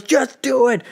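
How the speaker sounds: high mock yell without the volume; hammed-up, strained high command; desperate villain order. Dying Thanos overrules his underling, desperate mock yell.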